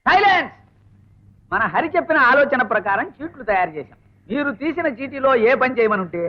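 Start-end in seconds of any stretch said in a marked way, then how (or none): 0.0-0.5 higher, raised-voice order; commanding call. Acted. Lecturer silences students before the game, sharp and commanding.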